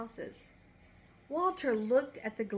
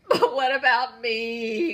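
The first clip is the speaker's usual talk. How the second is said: slow, loud, sobbing plea; the fairy crying out that she's stranded; tearful cry; 11 dB louder, slower than usual. Acted. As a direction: wail it through tears. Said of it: "cried the Tooth Fairy".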